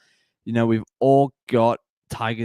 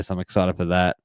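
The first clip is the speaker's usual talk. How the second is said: ordinary delivery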